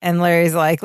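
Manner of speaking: ordinary talk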